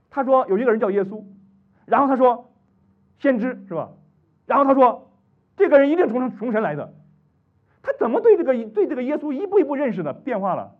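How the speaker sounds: high, loud, urgent questioning; fervent preaching. Acted. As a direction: press the congregation hard. Preaching at full force. (高声热切地讲道)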